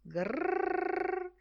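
high, purring growl; eager cat growl. Acted. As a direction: rumble with greedy delight. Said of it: "esclamò lei". (la gatta esclama felice davanti al latte)

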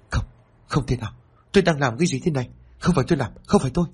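horrified muttering. A narrator's reading. Father staring at his bloody hands, panicking in denial. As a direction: deny it in growing horror. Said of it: "lẩm bẩm".

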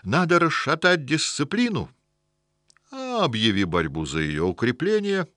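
high, emphatic, venomous sneer; venomous mockery. A narrator's reading. African scornfully explains politics to the house spirit, with venomous mockery. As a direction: sneer it out with big swooping stresses. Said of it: "продолжал с ядовитой усмешкой".

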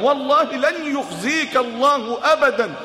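slow, emphatic oath; fervent oath. Acted. Khadija swears to reassure the Prophet, slow and firm. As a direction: swear it slowly, pressing each word.

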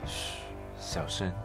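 hushed whisper, breath only. Acted. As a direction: shush them, then whisper softly.

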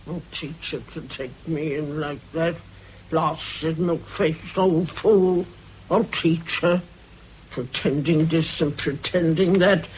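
low, spiteful muttering under her breath; muttering to herself. Acted. Agatha alone in her room, muttering quietly to herself. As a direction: grumble it quietly to yourself. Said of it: "and mutters to herself".

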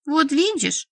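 high, hurt reproach; pained. A narrator's reading. Rejected young vampire to the healer, with pain. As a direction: let the hurt lift the voice. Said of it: "с болью выговорила маленькая вампирша".

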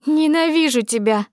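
wheezed, high-pitched cry of hate; hoarse hatred. A narrator's reading. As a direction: throw the hate at her. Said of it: "прохрипела она".

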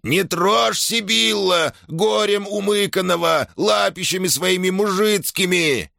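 very high, slow trembling squeal; shrieking old shaman. A narrator's reading. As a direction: squeal at her in outrage. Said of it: "взвизгнул трясущийся шаман".

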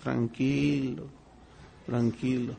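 quiet reassuring voice of God; calm soothing. Acted. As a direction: hush him gently, slowly. God calming the believer.

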